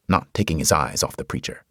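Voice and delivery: ordinary delivery, nothing marked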